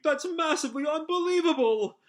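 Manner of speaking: very high-pitched frantic squeal; hammed-up panic. Moscow reacting to Shora's gravity powers, hammed-up panic. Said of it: "Moscow is really panicking".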